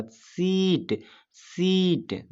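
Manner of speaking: सीट with a long high ई, falling off; stress on ई. Shown as a demonstration. Teacher leading students to repeat along, सीट stretched and stressed. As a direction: lean on the ई of सीट, drawn out. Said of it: "बोलिए आप मेरे साथ".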